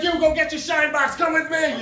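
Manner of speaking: high, loud Italian-mom voice; little Italian mom. Retold or imitated. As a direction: scold him like his tiny Italian mother. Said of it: "my little Italian mom".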